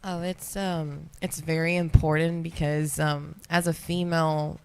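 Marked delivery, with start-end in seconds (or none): none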